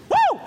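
very high, loud whoop; exhilarated high yelp; whooping "woo". Acted. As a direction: let out a leaping whoop. Peter jumps out of boat.

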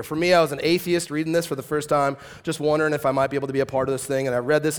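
ordinary talk

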